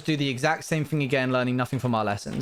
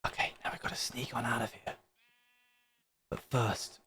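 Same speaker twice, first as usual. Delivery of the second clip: whispering, sneaking away quietly; 10 dB quieter than usual. Real, not acted. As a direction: hush it, as if sneaking out.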